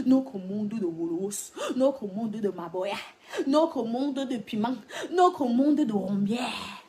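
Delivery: emphatic, lingering chant-like listing; drawn-out roll-call. Real, not acted. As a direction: stretch each phrase, swelling to the last. Emphatic roll-call of their world.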